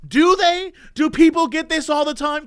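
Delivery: mock outraged disbelief at the doctor; incredulous. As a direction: throw it back at the doctor in disbelief. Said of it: "I'm like".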